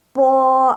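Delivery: Po high and level with a puff of breath; aspirated po. Shown as a demonstration. Teacher demonstrating Po for students to repeat. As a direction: breathe out hard on Po. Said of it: "Coba ikut Lao Shi".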